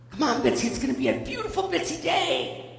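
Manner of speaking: high singsong dad wake-up call; mimicked morning pep; cheery. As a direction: chirp it like a relentlessly upbeat dad. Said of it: "just cheery as all get out".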